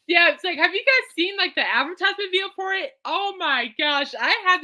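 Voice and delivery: high giddy question to the viewers; excited. Real, not acted. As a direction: still half laughing, buzzing with it. Still laughing, asking viewers.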